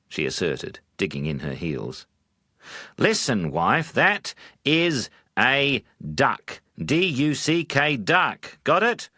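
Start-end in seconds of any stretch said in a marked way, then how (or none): 3.0-9.1 higher and louder, slow spelled-out insistence; angry husband. Acted. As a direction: spell out the word to win the argument. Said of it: "he said angrily".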